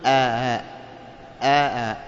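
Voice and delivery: "آ، آ" low, with a softened glottal catch; half hamza, half alif on آ, without lengthening; eased hamza. Shown as a demonstration. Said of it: "ليس هناك مد". ("آ" همزة مسهلة بين بين)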